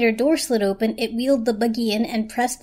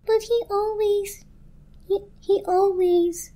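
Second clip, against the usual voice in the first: much higher, slow, faltering; broken blanket; 11 semitones higher, slower than usual. A narrator's reading. The blanket learning it was replaced, high and faltering. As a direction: voice breaking in disbelief.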